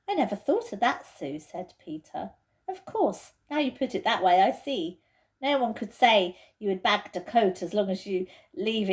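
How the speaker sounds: Peter's voice, convinced. Acted. Peter agrees with Susan's reasoning, convinced. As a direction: work it out as you go.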